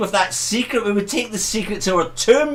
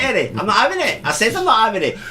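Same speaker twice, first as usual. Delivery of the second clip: ordinary talk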